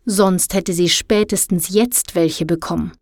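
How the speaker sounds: usual speaking voice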